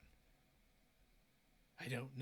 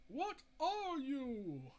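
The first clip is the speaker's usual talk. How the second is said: slow, higher, louder demanding question; Mira's demand; 6 semitones higher, 5 dB louder, much slower than usual. Retold or imitated. Mira confronting the creature, slow and higher pitched. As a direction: stretch it out as a challenge. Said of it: "And then says".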